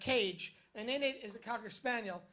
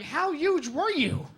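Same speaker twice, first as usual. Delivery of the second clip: slow, louder, drawn-out disbelief; mock astonished question; 4 semitones higher, 7 dB louder, slower than usual. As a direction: drag it out like you can't believe it. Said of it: "it's like".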